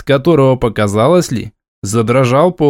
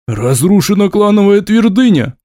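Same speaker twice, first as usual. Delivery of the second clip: higher, louder angry outburst; 8 semitones higher, 3 dB louder than usual. A narrator's reading. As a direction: lose your temper on it. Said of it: "сорвался князь".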